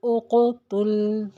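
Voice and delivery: उक़तुल with the क़ bounced, slow and drawn; qalqala on qaf. Shown as a demonstration. Teacher demonstrating qalqala on उक़तुल. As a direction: jolt the क़ in उक़तुल then release. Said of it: "जैसे क़ाफ़ की मिसाल देखेंगे".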